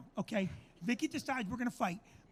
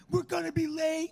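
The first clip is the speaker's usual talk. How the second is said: higher-pitched mock fretting wife; nagging worrier; 5 semitones higher than usual. Husband mimicking his wife before airport trips, nagging worrier. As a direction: imitate a wife fretting about time. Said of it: "Vicky's always like".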